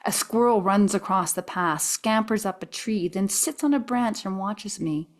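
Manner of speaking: ordinary delivery, nothing marked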